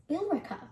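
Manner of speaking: Billerica as bill-RICK-uh; local pronunciation. Shown as a demonstration. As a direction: drop the e in the middle of Billerica. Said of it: "is actually pronounced as".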